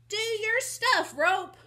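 high, loud cowgirl call; spirited, high-pitched order; urgent command. Acted. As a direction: holler it to your trusty lariat. Wynetta commands her rope mid-fight.

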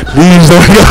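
lightly hammed-up plea; mock begging. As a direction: plead with her, half joking. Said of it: "I was begging".

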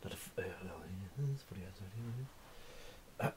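hushed whisper, slow. Real, not acted. Whispering a correction to the viewers, hushed.

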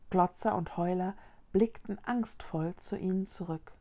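ordinary delivery

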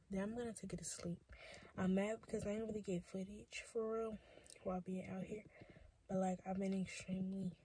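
very soft, tired murmur; hushed late-night talk. Real, not acted. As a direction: keep it near a whisper, worn out.

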